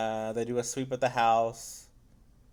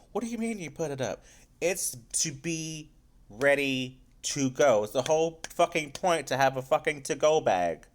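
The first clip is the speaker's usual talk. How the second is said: exasperated retort, higher pitched; irritated; 4 semitones higher, 3 dB louder than usual. Retold or imitated. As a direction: imitate an annoyed scolding, leaning on each point. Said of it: "So she's irritated with her".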